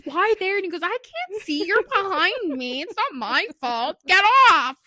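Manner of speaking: imitating an aggrieved boyfriend, swooping pitch; whiny retold protest building to a loud last order; mock indignant protest. Kicked boyfriend objects to girlfriend, mock indignant. Retold bedtime quarrel after kick.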